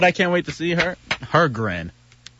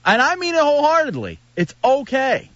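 a pitched-up, overly sincere reassurance; higher mock earnest plea; 10 semitones higher than usual. Host to his cohost, ham up a sincere, higher pitched speech. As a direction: lay it on thick with fake sincerity.

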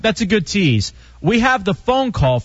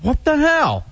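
surprised; 9 semitones higher than usual. Real, not acted. Startled by something in studio.

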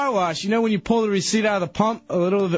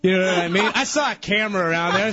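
usual speaking voice

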